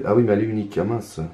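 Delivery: ordinary talk, nothing marked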